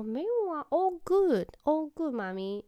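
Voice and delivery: little son's voice. Retold or imitated. Mom retelling her son's innocent denial, in a high, drawn-out child voice. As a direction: play the sweet three-year-old brushing it off. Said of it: "他们跟我讲说".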